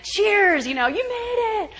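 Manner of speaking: high mock cheer; lightly hammed-up hurrah; crowd erupting. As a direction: imitate a crowd celebrating a rescue. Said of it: "people are gonna erupt".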